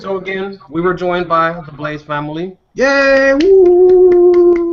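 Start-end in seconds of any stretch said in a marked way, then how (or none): 2.8-4.7 high, loud cheer. Real, not acted. Host cheering the Blaze family at the wrap-up, loud whoop. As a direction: whoop it up for the guests.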